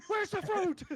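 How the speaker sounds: high, loud, frantic demand; player's hero bursting in, yelling for fruit. Retold or imitated. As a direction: blurt it out in a mad rush. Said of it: "You're like".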